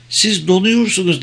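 high-pitched cry at ordinary volume; mild exclamation. A narrator's reading. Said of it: "diye bağırdı". (hafifçe bağırır gibi, tiz sesle)

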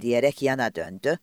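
ordinary delivery

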